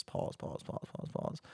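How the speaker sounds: breathy, fading whisper. Shown as a demonstration. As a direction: whisper it trailing off. Mocking the apology's long pauses.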